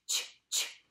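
ch, ch, ch as a soft hissed burst; ch sound. Shown as a demonstration. Teacher modelling the ch sound for kids, breathy and unvoiced. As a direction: say ch, ch with breath only, no voice. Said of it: "I want you to say ch".

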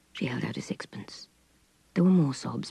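usual speaking voice

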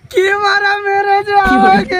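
slow, drawn-out comic wail; crying. Acted. Beaten husband telling his friend, crying. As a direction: bawl it out slowly. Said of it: "আর কাঁদিস না ভাই".

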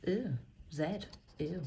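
ordinary talk

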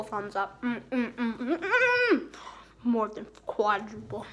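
talking while chewing candy; muffled mm sounds while eating; mouth full, chewing. Real, not acted. Seen 0: Bar to mouth at -1.0; lips pursed and chewing through labelled words.

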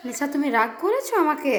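ordinary talk, nothing marked

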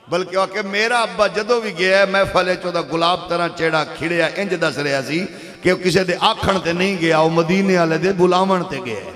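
loud, forceful sermon delivery; fiery preaching. Real, not acted. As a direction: preach it at full force to rouse the crowd.

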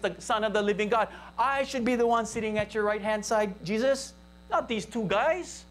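ordinary talk, nothing marked

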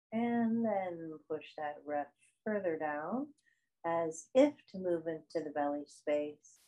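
soft, spacious yoga nidra guidance; guided meditation. Acted. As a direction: guide them unhurriedly through breath and body, softening as you go.

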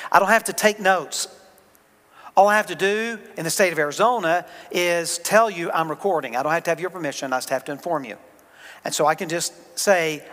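usual speaking voice

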